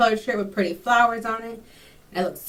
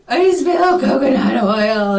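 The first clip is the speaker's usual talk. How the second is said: loud, drawn-out blubbering, acted out; mock tearful wail; 4 semitones higher, 7 dB louder, much slower than usual. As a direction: imitate wailing through tears, high and slow. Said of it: "just the tears start coming again".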